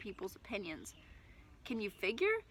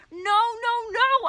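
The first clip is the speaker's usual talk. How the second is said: loud, much higher frantic yell; 13 semitones higher, 10 dB louder, much slower than usual. Acted. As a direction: yell in panic. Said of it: "I yelled".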